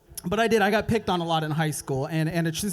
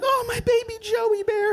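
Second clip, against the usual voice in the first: high cooing mom voice; a high, sugary mommy voice; doting mom; 14 semitones higher than usual. Retold or imitated. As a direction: coo it up high like a doting mother. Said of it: "who will be like".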